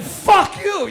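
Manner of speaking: loud, high-pitched outburst; angry retort. Acted. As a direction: shout it in mock outrage. Acted angry retort to store guy.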